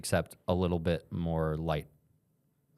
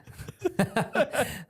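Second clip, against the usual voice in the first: high burst of laughter. Real, not acted. Laughing at his friend's dumb joke, high burst of laughter. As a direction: crack up high.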